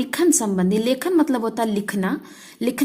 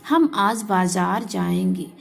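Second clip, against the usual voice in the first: "हम आज बाजार जाएंगे" said slowly, word by word; slow model sentence; 2 semitones lower, slower than usual. Shown as a demonstration. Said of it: "सही तरीका इस शब्द का ये है". (सही वाक्य धीरे धीरे, हर शब्द अलग)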